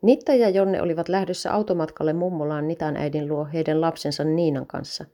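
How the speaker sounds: plain reading voice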